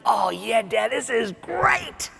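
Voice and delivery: put-on a contented little boy answering his dad; happy kid voice. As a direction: kid beaming in right field.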